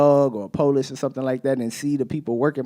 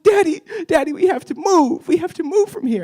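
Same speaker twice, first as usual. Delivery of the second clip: put-on much higher and louder child voice, pleading; distraught daughter; 5 dB louder than usual. A daughter running up to her dad in tears, high pleading child voice. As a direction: plead like a scared little girl. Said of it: "She's like".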